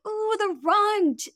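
high, slow cooing at a puppy; cooing over the runt. Retold or imitated. Charmed by the runt puppy at the breeder, slow high coo. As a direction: melt over the tiny puppy, high and drawn out. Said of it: "And I was like".

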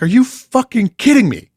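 high, loud exasperated outburst; mock yell at the wrecked toothpaste tube. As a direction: blow up at her in disbelief. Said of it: "And I'm like".

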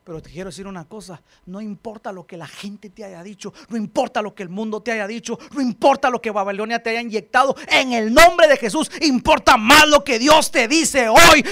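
usual speaking voice

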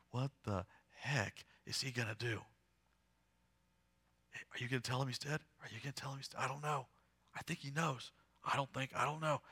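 quiet, doubtful asides among onlookers; murmuring crowd. Acted. Puzzled crowd following Jesus to the tomb, murmuring low.

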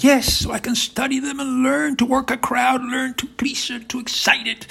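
high-pitched fawning enthusiasm; Putin, eager admirer. Acted. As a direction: gush up high like an adoring pupil.

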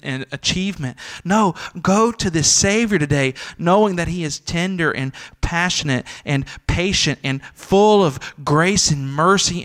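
loud, high, impassioned plea to the congregation; fervent gospel appeal building to a climax; full-force preaching. Acted. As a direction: preach it out, pleading and soaring. Rising altar call.

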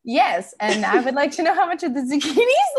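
high, loud, pointedly polite; exasperated insistence. Retold or imitated. Customer cutting short the shopkeeper's small talk, exasperated insistence. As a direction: press the question through mounting exasperation. Shopper insists on her question, retold.

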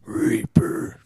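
monster truck announcer. Pretend. Dramatic growled product hype.

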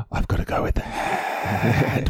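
whispered, slowed inner panic; hushed mock panic to himself; panicked whisper. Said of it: "and I went".

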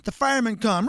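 ordinary talk

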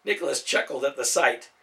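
ordinary delivery, nothing marked

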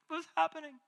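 high, small panicked quote; mock alarm. Preacher voicing people watching the stock market crash, mock alarm. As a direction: lightly squeak out a worried question.

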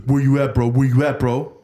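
retold bro-style call-out to a fight; confrontational. Angry caller challenges call taker to a fight, mock confrontational. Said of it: "He wants to fight you?".

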